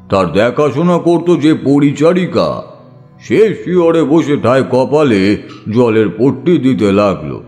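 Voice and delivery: usual speaking voice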